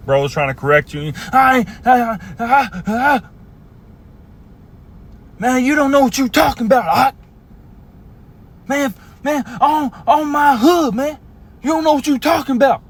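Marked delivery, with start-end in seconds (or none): 1.3-12.8 high, whiny pushback at being corrected; a petulant young man brushing off counsel; defensive sulky brother. Acted. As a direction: squeal it like a kid caught out.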